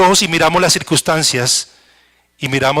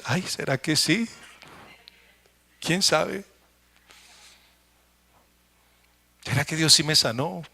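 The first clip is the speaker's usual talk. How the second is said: much softer, slow worried wondering; quietly losing faith mid-thought; doubting inner voice; 9 dB quieter than usual. Retold or imitated. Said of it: "viene una circunstancia y después".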